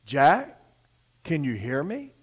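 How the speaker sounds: low, soft voice of the Lord; quiet, deep divine voice; God's voice from above. Acted. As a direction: call down gently and low, as the Lord. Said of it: "he heard this voice".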